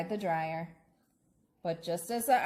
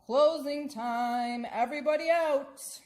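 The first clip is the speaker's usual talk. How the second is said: calling out; 6 semitones higher, 3 dB louder than usual. Acted. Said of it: "the manager of the laundromat called".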